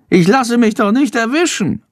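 cocky bat in a very high voice; Shandor the bat, boasting. A narrator's reading. Bat boasting to boy of his escape, high cocky squeak. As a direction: brag in a squeaky little bat voice. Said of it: "prahlt Shandor".

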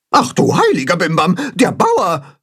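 high, startled character voice; alarmed chatter. A narrator's reading. Grimm spots the farmer coming, chattering in alarm. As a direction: raise the alarm, pitched high. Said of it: "schnattert Grimm alarmiert".

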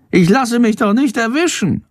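high, cocky little bat voice; Shandor boasting. A narrator's reading. Bat shrugs off the danger, high boastful squeak. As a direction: brag in a squeaky creature voice. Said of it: "prahlt Shandor".

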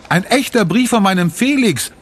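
put-on high, eager child's voice; a girl showing off her letter, pitched high; excited girl. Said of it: "ruft sie".

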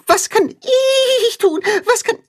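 very high begging puppy voice. A narrator's reading. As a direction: beg eagerly to help. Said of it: "bettelt Tacho".

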